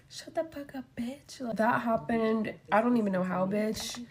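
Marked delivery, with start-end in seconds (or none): 0.1-1.4 very soft imitation of a retort; muted retort. Driver retelling how she left mad, hushed mock retort. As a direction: replay the insult under your breath. Retold retort after leaving angry.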